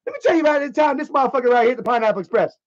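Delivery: high, ranting woman voice; imitated indignant woman. Girlfriend retelling the Pineapple Express night, mock indignant. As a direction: mimic her launching into the old grievance. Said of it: "she's like".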